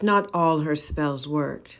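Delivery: ordinary delivery, nothing marked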